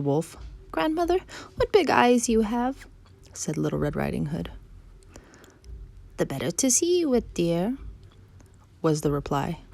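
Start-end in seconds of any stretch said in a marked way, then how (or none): 0.7-2.9 put-on high-pitched childlike wonder; wondering little girl. Girl marvels at grandmother's looks, high childlike voice. 6.2-7.8 high, swooping sly wolf; wolf posing as grandmother. Acted. Wolf answers the girl from bed, high swooping pretence.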